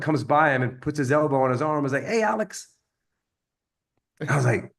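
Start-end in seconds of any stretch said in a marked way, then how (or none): 2.0-2.7 lightly voiced other guy calling Alex, higher; a casual gym buddy's hello, pitched up; friendly greeting.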